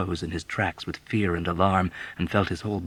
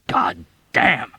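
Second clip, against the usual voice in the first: bitter exclamation; 9 semitones higher, 5 dB louder than usual. Acted. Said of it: "Yossarian exclaimed bitterly".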